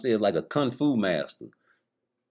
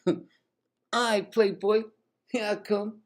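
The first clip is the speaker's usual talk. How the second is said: higher, fast eager [name]; [name] eagerly accepts [name]'s party invite, fast and high; [name] voiced; 10 semitones higher than usual. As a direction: hurry to accept the invite, pitched up.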